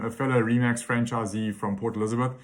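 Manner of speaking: ordinary talk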